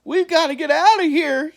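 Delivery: panicked. A narrator's reading. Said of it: "he croaked".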